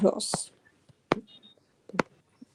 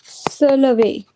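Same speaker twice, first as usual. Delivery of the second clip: slow model reading; 4 dB louder, slower than usual. Shown as a demonstration. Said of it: "To get up".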